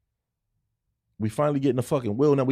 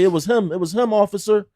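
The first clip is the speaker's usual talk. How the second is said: put-on higher, louder kid voice; imitating kid; 8 semitones higher, 5 dB louder than usual. Retelling the boy snitching to the cop, kid voice. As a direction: play the eager tattling boy. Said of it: "watched him walk up to the law and say".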